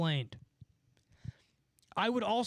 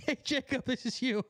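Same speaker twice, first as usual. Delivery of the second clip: laughing taunt; 7 semitones higher than usual. Real, not acted. Taunting viewer through laughter.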